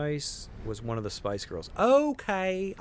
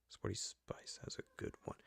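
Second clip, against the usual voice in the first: whisper; 2 semitones lower, 14 dB quieter than usual. Real, not acted. Repeating her remark to viewers as a whisper. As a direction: breathe the same line again as a secret.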